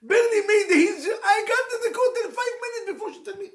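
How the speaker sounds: loud, high laughter through the words; laughing. Real, not acted. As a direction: crack up while telling it.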